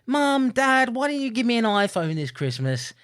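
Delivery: put-on high-pitched child asking parents for a present; pleading kid. Kids asking parents for an iPhone, voiced high. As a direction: pipe up like a demanding child. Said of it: "when they say to you".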